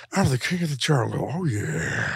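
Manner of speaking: lightly voiced gravel-free Pacino lion boast. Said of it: "I want Pacino to play Cocaine Lion".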